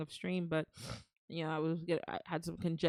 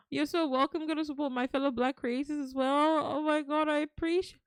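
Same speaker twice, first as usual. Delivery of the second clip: loud high stunned thanks; overwhelmed excitement; 9 semitones higher, 4 dB louder than usual. Real, not acted. Gifted subs flood in, stunned loud high joy. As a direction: shriek with disbelieving joy.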